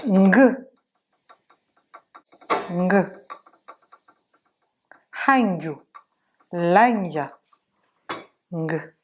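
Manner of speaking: "ng" sounded alone and in "Hangyu"; isolated, careful "Ng"; Malagasy ng demo. Shown as a demonstration. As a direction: model "ng", "Hangyu", "Lanya" one by one. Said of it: "On a fini les prononciations".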